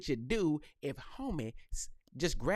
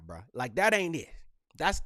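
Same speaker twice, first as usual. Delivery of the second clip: ordinary delivery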